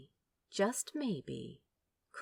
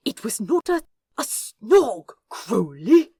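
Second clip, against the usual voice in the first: hissed denial; 4 semitones higher, 12 dB louder than usual. Acted. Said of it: "hissed the Angel".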